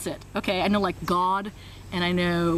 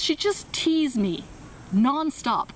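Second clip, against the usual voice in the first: normal talking voice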